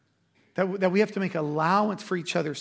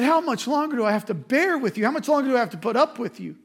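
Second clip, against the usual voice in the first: raised, higher, fed-up preaching voice; exasperated Jesus; 5 semitones higher, 4 dB louder, faster than usual. Acted. Jesus to faithless disciples, loud exasperated rebuke. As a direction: let the frustration ring out loud.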